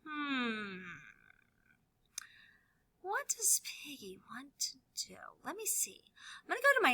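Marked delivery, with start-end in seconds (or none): 0.1-5.2 soft whispered wondering; thinking aloud. Acted. Piggy wondering what he wants, in a soft whisper. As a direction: muse it quietly to yourself. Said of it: "Piggy is thinking".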